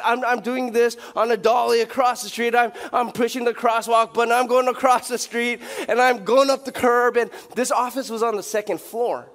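loud, driving sermon build-up; preaching at full force. Acted. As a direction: push the story hard from the pulpit. Preacher retelling the move, heated.

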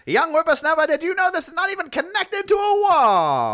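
put-on high old-timey announcer voice; 1950s announcer voice. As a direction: ham up a vintage radio host, up high. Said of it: "nineteen fifties announcer voice".

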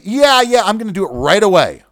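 high, eager fake promise; mock husband. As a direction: brush her off with a bright yes.

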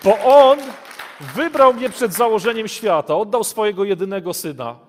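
loud, high, fervent sermon voice; full-force preaching. Acted. Preacher rousing the congregation, loud and fervent. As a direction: preach it out to the hall.

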